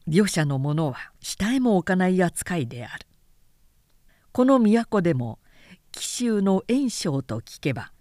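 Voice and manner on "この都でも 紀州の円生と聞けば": usual speaking voice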